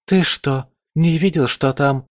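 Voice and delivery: высокий изумлённый вопрос; спроси, не веря ушам; ошарашенно. A narrator's reading. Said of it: "даже обалдела Юля". (изумлённо, повышенным тоном)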